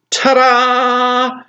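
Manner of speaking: high, drawn-out ta-da; mock fanfare. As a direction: trumpet the big reveal in jest.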